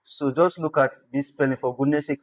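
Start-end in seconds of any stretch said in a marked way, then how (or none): none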